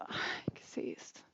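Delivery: tonlos geflüstert, staunend; flüstere es verwirrt vor dich hin; whispered wonder. Retold or imitated. Crying in church, inner wonder.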